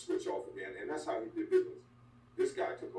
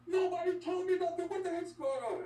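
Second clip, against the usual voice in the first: high, loud fake innocence; mock innocent boss; 3 dB louder than usual. Wrestler mocking the boss as he fakes not knowing, in a high put-on voice. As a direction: play dumb like the boss who knows it all. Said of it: "you're doing a good job acting".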